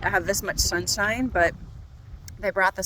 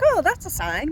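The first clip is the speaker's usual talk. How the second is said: excited realisation; 10 semitones higher, 6 dB louder than usual. Retold or imitated. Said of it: "And I was like".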